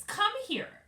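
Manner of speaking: very high, loud command; a shrill high order from the patio. A narrator's reading. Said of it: "she said in a high voice".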